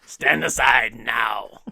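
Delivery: loud, drawn-out villain command; Voldemort. Acted. Podcast reader playing the villain at a pleading mother, loud commanding menace. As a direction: order her away slowly and forcefully. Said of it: "that's my Voldemort voice".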